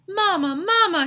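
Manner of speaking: high, loud wailing cry; sobbing. A narrator's reading. Stinky cries over his mother hit by the car, wailing. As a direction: call for the dead mother. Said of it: "he sobbed".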